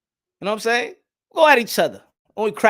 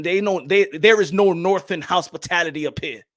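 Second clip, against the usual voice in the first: ordinary talk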